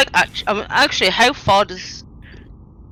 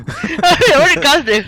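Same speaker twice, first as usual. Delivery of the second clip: high, loud, slow laughing question; 8 semitones higher, 5 dB louder, slower than usual. Real, not acted. Player laughing at the GM's name mixup, laughing. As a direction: ask it through a big laugh.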